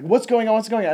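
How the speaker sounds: mock the captain's alarm in the dark; panicked captain. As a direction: pirates on the bridge, lights out, imitated panic. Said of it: "And it's like".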